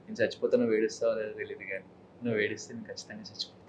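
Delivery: soft romantic film dialogue. Shown as a demonstration. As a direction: murmur it tenderly to a lover. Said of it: "romantic గా".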